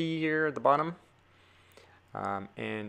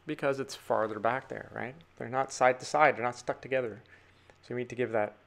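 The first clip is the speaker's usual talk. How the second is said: ordinary talk, nothing marked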